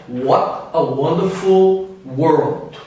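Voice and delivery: measured British delivery, each word separate; British accent. Shown as a demonstration. Teacher contrasting with the American version, British accent. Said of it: "Já o britânico falaria".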